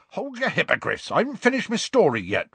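high, quick rustic protest; indignant. A narrator's reading. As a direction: cut them off, affronted. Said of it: "said Hagrid indignantly".

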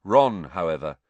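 ordinary delivery, nothing marked

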